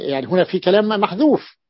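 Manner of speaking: ordinary talk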